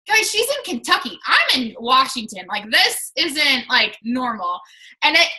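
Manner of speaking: ordinary talk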